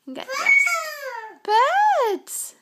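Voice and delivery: very high loud exclamations. Real, not acted. Excited play with baby.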